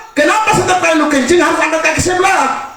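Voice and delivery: high, insistent repeat of the question. Retold or imitated. Cat repeats question, higher.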